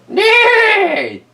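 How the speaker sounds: high shouted whinny; horse neigh. Acted. A horse in the barn neighs, high shouted whinny.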